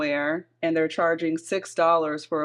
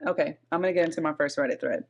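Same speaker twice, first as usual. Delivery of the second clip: normal talking voice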